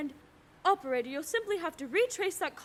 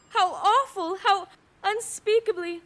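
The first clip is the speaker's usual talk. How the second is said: appalled, louder and higher, breathless horror; horrified gasp; 4 semitones higher, 8 dB louder than usual. Acted. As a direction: recoil in horror at what she heard. Overhearing murder plot, horrified.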